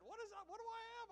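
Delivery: very high, loud, puzzled question; high, loud bewildered asking; confused granddad. Retold or imitated. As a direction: play the old man who forgot his illness. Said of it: "he said".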